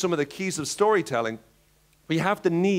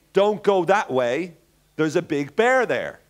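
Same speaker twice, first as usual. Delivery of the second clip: slow warning; slower than usual. Acted. Warning friends about a bear.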